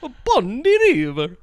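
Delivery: a hint of a high Rasta accent; lightly voiced Italian Rasta patter. Friend riffing on the pastafarian, light Rasta accent. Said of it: "do the accent".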